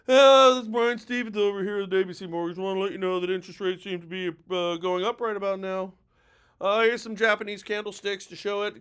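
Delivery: mock droning mortgage presenter, monotone and trailing off; dull, unemphatic pitch. Shown as a demonstration. Mortgage agent showing charts on a live, mock dull and unemphatic. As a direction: drone through the charts with no urgency at all. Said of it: "No. Scream it emphatically".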